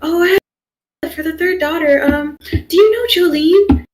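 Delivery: retold excited question. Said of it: "she was so excited".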